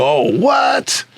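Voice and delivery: very high, incredulous retold "what"; pissed-off disbelief. Organizer hearing the crowd curse his show, pissed off. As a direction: re-enact being outraged, pitch leaping way up. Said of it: "So I was pissed".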